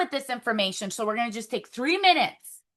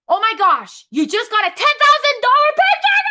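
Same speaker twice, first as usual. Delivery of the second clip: much higher, louder squeal of joy; thrilled; 10 semitones higher, 7 dB louder than usual. Pretend. Celebrating an imagined big paycheck, high and loud. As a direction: shriek like you just got paid. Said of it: "I want you to feel that feeling".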